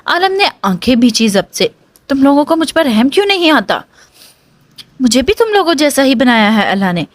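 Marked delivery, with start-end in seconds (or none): none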